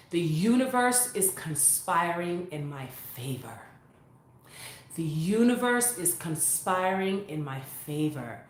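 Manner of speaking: slow, soft, measured affirmation; mantra recitation. Shown as a demonstration. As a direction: recite it softly to calm yourself.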